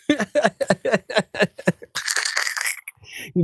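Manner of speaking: loud, high burst of laughter trailing into wheezes; helpless real cackle; long cackling laugh. Real, not acted. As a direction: crack up at your own joke. Cracks up at own joke.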